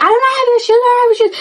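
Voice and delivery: high, squeaky put-on girl voice; mocking denial. The accused girl denying she has the shoes, mocked. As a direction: mimic her whiny denial.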